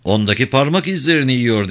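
ordinary delivery

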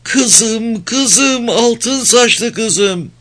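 high-pitched, anguished wail for a daughter; acted mother's keening scream; bloodcurdling cry. A narrator's reading. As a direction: cry out in grief, drawing out the last words. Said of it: "tüyler ürperten çığlığı duyuldu".

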